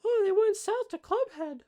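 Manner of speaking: put-on very high little kid voice; small child. Young halfling answering the adults' questions, in a high child voice. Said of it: "this child cannot".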